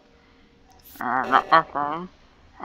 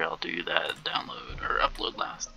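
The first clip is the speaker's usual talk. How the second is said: whispering; 10 semitones lower, 7 dB quieter than usual. Real, not acted. Muttering to self while playing.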